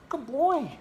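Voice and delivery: very high, loud pet-talk voice; high-pitched dog praise. Real, not acted. Owner praising the dog for eating, very high doting praise.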